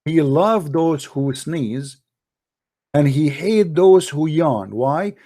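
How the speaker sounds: usual speaking voice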